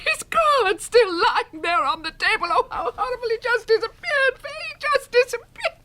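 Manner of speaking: bewildered and close to tears; distraught shock. Acted. Margaret distraught after husband vanishes.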